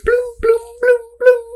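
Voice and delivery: very high ringing bloom sounds; slot machine chime. Acted. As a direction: make the reels chime in a high voice. Slot machine reels lining up.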